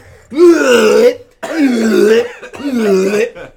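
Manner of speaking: big high-pitched laugh; loud high laugh. Real, not acted. Laughing at the sweeping vomit.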